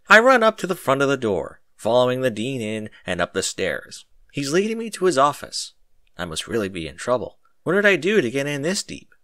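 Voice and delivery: normal talking voice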